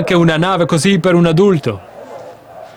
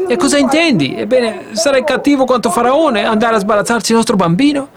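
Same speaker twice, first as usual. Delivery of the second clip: high, upset wife's voice; a mother pleading against the plan; accusing wife; 8 semitones higher than usual. Acted. Jochebed confronting Amram, high and upset.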